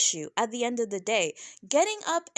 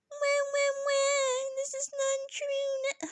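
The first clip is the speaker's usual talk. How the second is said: very high, drawn-out fake wail; mock crying; slower than usual. Reviewer mocks Jen crying on the other side, fake wailing. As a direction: imitate a crybaby with exaggerated wailing. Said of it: "and she's crying like".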